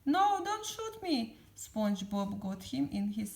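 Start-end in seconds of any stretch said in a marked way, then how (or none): 0.0-1.4 high, terrified plea; acted cry of panic, pitch way up; Mister Krabs. A narrator's reading. Mister Krabs facing pop guns, terrified. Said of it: "Mister Krabs was terrified".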